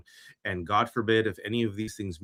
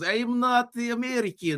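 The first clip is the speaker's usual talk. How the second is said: mock Russian accent, much higher; 12 semitones higher than usual. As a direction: deny being American in a thick Russian accent. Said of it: "Danielle should do that accent".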